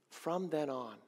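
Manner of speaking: normal talking voice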